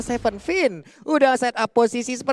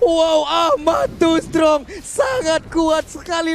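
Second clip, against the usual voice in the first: excited; 4 semitones higher, slower than usual. Real, not acted. Marvels at hero strength.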